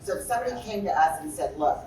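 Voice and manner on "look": ordinary talk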